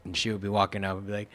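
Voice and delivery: usual speaking voice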